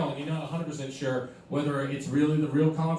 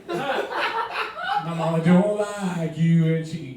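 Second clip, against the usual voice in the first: laughing lyric; 4 dB louder, much slower than usual. Pretend. Repeats the lyric through laughter.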